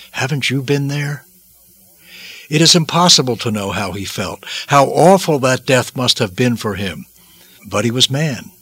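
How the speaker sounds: ordinary talk, nothing marked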